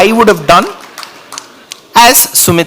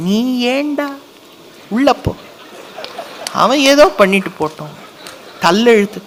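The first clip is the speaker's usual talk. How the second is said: softer, hushed scolding of a son; scolding mother; 5 semitones higher, 6 dB quieter, much faster than usual. Pretend. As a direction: shoo the boy back indoors. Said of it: "What would I be telling in the place of Sumitra".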